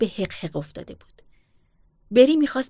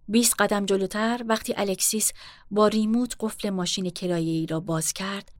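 plain reading voice

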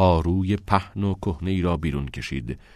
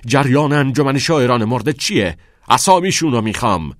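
loud, raised, demanding yell; furious shout; 5 semitones higher, 8 dB louder than usual. A narrator's reading. Principal demanding names from a pupil, shouting. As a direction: shout the demand, pitch and volume up. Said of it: "نولان فریاد زد".

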